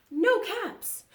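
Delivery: high, emphatic storybook dismay; dismayed discovery. A narrator's reading. Narrator to children as the peddler searches, dismayed. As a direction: stretch it out high, finding nothing there.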